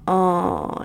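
usual speaking voice

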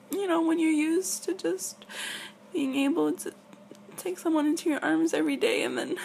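fighting back tears while explaining; getting emotional. Real, not acted. Girlfriend tells her faraway partner she misses him, near tears. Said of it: "I'm getting a bit emotional".

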